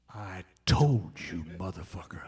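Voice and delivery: imitating a gloating laugh in a low voice; smug told-you-so. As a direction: drag it out low like a satisfied old bluesman. [name]'s told-you-so.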